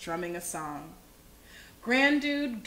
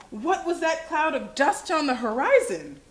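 ordinary delivery

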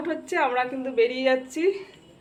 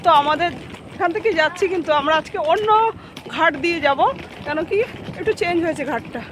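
higher, louder over the noise; loud and raised; 9 semitones higher, 8 dB louder than usual. Real, not acted. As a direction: raise your voice outdoors. Talking loudly over outdoor noise.

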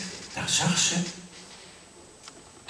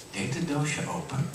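usual speaking voice

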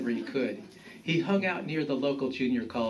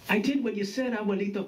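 higher-pitched aggrieved grandson; complaining; 9 semitones higher, faster than usual. A narrator's reading. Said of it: "complained Juan".

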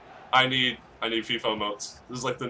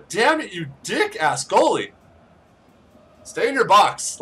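higher, slow swearing; exasperated outburst; 7 semitones higher, 6 dB louder, slower than usual. Real, not acted. Gamer cursing the goalie, higher exasperated voice. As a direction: curse out the goalie word by word.

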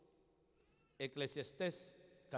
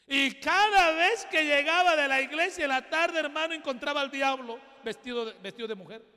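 full-force preaching; 9 semitones higher, 13 dB louder than usual. Acted. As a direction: hammer the point home to the congregation.

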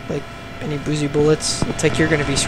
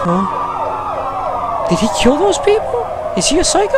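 shocked disbelief; slower than usual. Real, not acted. Shocked by cutscene killing.

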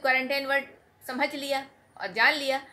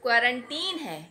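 ordinary talk, nothing marked

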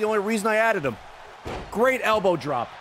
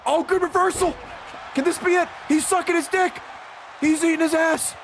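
excited; 8 semitones higher than usual. Real, not acted. Streamer hyping a near-finish in the match, excited shouting. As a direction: call the action like a hyped fan.

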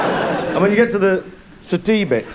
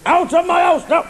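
angry father; 13 semitones higher than usual. Acted. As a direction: throw the lad out of the house. Father throws lad out.

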